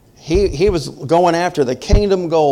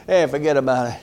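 New York saying; faster than usual. Retold or imitated. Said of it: "there's a saying in New York City".

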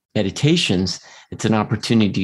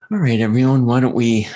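calling out; 3 semitones higher, 4 dB louder than usual. Real, not acted. Host calls group to start.

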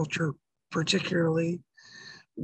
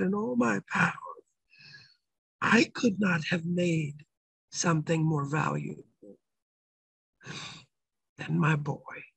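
tender voice of the Lord; 4 semitones lower, 5 dB quieter than usual. Retold or imitated. Lord tenderly telling a son his worth, fading soft. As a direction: let each phrase sink gently lower. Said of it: "so tender, so sweet".

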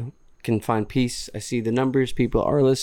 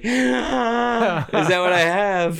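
shocked gasp; 9 semitones higher, much slower than usual. Retold or imitated. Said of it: "you were like".

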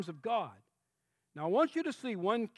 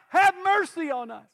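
loud high plea for mercy; preacher acting the blind men's loud cry; 7 semitones higher, 9 dB louder than usual. Shown as a demonstration. Blind men crying out.

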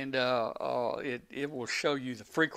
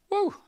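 excited woo; 6 dB louder than usual. Real, not acted. Relief after fixing radio.

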